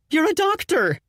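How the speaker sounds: high, frantic insistence; desperate. Acted. Fiancée insists doctor Kip handle bird, high frantic. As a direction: push him, nearly shrieking.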